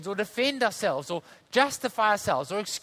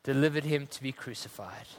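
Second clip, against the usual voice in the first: solemn reading; 5 semitones lower than usual. Acted. Reads scripture and hymn solemnly.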